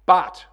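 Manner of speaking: but thrown out very loud; shouted but. Shown as a demonstration. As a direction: shout but to make it stick. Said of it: "remember that word".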